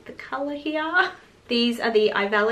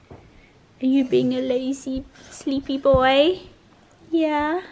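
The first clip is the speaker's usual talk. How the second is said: higher, slow, cooing pet voice; 4 semitones higher, 3 dB louder, slower than usual. Real, not acted. To her dog Alfie on the couch, slow higher coo. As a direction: coo it slowly to the sleepy dog.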